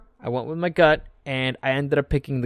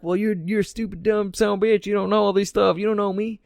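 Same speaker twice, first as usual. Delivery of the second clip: mock defiant rant; 6 semitones higher than usual.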